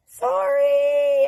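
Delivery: loud, high, long-held word; called-out apology. Retold or imitated. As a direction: call out sheepishly from hiding. Caught child calls out apology.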